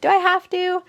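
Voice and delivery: high, pleading little voice; frightened patient asking about the catheter; scared. Retold or imitated. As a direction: beg to get out of the needle. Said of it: "which terrifies me".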